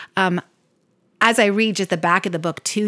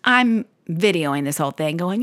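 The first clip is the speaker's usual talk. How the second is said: ordinary talk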